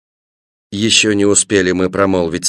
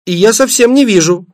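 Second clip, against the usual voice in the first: put-on high-pitched little-girl grumble; childish complaint; 9 semitones higher than usual. A narrator's reading. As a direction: complain plaintively in a raised child's voice. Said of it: "пожаловалась Светлана". (детская жалоба)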